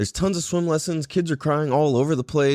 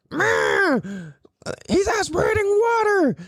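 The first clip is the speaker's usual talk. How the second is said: very high mock screech; alarmed old lady; slower than usual. Mocking the old lady's protest, shrieky alarm. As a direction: caricature a panicking old woman. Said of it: "this fucking old lady".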